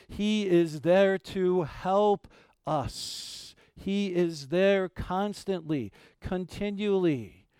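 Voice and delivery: drawn-out preacher's stress; slow emphatic preaching. Acted. Pastor reassuring the groaning church, slow emphatic preaching. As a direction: stretch every word to reassure.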